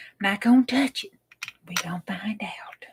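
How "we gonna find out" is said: whispered anticipation. Real, not acted. Whispered waiting for result.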